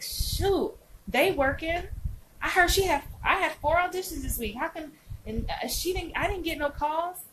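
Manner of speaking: imitação de atriz invejosa, voz mais aguda; mock envious actor. Mimics envious, comparing actor.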